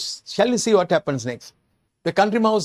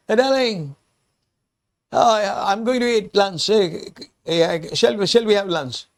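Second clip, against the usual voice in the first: man answering; 6 semitones higher than usual. Acted. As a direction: answer her cheerfully.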